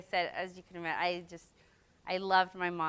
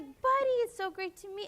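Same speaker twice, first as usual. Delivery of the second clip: starstruck quote; 12 semitones higher than usual. Retold or imitated. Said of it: "And he was like".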